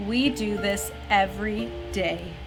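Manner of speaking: ordinary delivery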